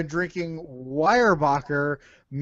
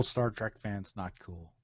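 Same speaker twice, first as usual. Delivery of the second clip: trailing off, much softer; fading quiet; 10 semitones lower, 13 dB quieter than usual. Real, not acted. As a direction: let it fade to almost nothing. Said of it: "repeated scolding, fading out".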